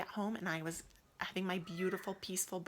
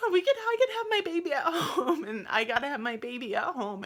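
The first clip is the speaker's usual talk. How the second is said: high, loud, crying with relief; tearful joy; 7 semitones higher, 8 dB louder than usual. Retold or imitated. Mother told she can birth at home, tearful joyful cry. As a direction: cry the words out, overcome. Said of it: "And I started crying".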